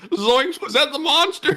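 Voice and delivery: high cartoon Shaggy impression. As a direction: squeal it like a scared cartoon stoner. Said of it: "and they're like".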